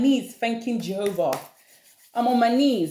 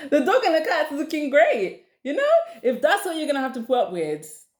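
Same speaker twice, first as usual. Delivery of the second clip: higher, laughing through the words; 6 semitones higher than usual. Real, not acted. Speaker cracking up at her own joke about pets, laughing. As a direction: crack up while repeating the joke.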